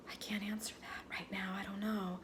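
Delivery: hushed, unvoiced whisper of an answer; whispered reply. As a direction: breathe it out barely audible, unsure. Retelling Christina's hushed reply.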